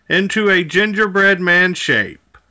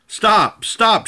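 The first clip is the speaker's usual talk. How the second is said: mild mock shout; 7 semitones higher than usual. A narrator's reading. Old couple calling after the runaway gingerbread man, mild shout. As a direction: call out two short stops, understated. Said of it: "shouted the little old woman and the little old man".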